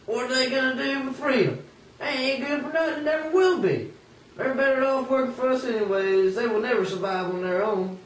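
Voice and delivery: high, sneering master voice; a touch of a scornful master. Acted. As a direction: sneer at the idea of freedom. Master sneering at freed slaves.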